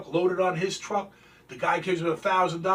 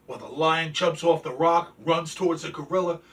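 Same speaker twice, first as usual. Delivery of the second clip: ordinary delivery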